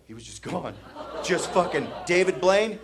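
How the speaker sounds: usual speaking voice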